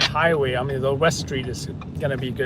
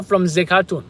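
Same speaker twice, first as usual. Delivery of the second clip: normal talking voice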